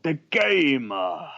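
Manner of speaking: drawn-out wrestler growl on the last syllable; hammed-up wrestling promo; mock wrestler voice.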